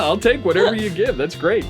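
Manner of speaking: ordinary delivery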